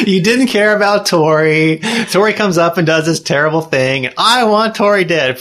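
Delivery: high, slow, laughing mockery; laughing teasing. Real, not acted. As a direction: tease through laughter.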